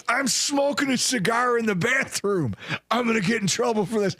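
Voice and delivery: high mock yell of a friend; retold drunken hollering. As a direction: imitate a buddy hollering down the phone.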